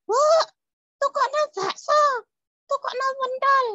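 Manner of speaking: put-on very high, loud puppet-child yelling; shouted child demand. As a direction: yell for food like a demanding kid. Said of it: "Mintanya sambil teriak-teriak". (anak kecil minta sambil teriak-teriak)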